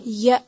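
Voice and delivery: "या" said as a short "ya" stopped in the throat; clear hamza. Shown as a demonstration. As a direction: end "या" abruptly on a glottal stop. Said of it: "हमजा को वाज़े करें". ("या" को छोटा रखकर हमज़ा पर रोकें)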